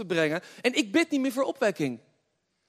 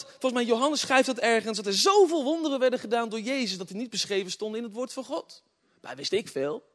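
usual speaking voice

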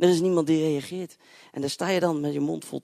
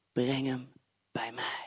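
soft, low, gentle call; tender command; 4 semitones lower, 6 dB quieter, slower than usual. Acted. Jesus telling the father to bring his son, soft and low. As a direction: invite him in quietly. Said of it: "En dan zegt hij dit".

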